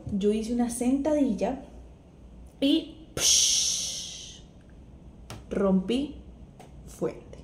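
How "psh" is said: long hissing "psh" of water; imitated gush. Woman showing how her waters broke, imitated gush. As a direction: imitate waters bursting with a hiss.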